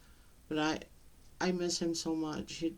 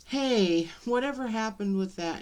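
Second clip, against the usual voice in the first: louder, higher, puzzled call-out; re-enacted question; 5 semitones higher, 6 dB louder than usual. Mother calls out to her son about his story, louder and higher. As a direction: pipe up loudly with a question.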